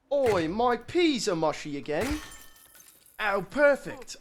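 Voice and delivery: cockney grumble sliding towards Australian; cockney accent. Acted. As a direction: complain in a bad cockney accent. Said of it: "Are we feeling cockney?".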